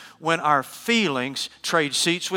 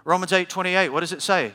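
normal talking voice

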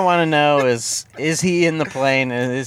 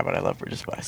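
whisper; 10 dB quieter than usual. Real, not acted. Repeating it under breath.

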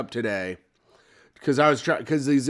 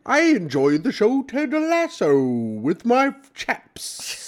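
higher mock British accent, last word breathed; mock British chap; 8 semitones higher, slower than usual. Joking to his cohost in a mock British accent. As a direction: put on a posh British pub voice. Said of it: "Oh my God".

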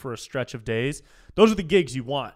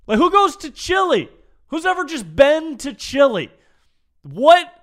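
loud, high-pitched comic outrage; incredulous rant; 11 semitones higher, 8 dB louder than usual. Real, not acted. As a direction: shout it in utter disbelief. Baffled rant at the casual question.

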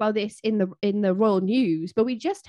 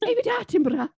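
put-on child voice; 11 semitones higher, faster than usual. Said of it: "my Charlotte impression".

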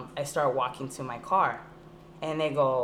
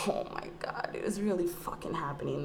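whispered aside; 8 dB quieter than usual. Retold or imitated. Retelling her aside to herself during the search, whispered. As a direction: whisper it, much softer, worn out.

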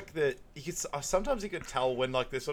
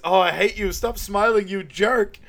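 loud, high mock rage; comic pained scolding; imitated angry outburst; 8 semitones higher, 8 dB louder than usual. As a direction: snap at someone laughing at your pain. Acting out anger at laughing waxer.

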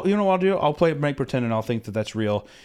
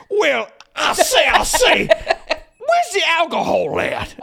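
much higher, louder, very slow Southern rooster drawl; Foghorn Leghorn; 7 dB louder, much slower than usual. Retold or imitated. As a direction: ham up the cartoon rooster, stretching every word. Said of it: "more and more like Foghorn Leghorn".

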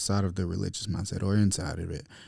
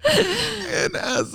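high, slow, hammed-up holy tone; milk the sacred moment; mock holy voice.

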